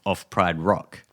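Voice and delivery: ordinary talk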